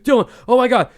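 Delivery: high, loud panicked cry; freaking out. Retold or imitated. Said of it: "like, freaking out".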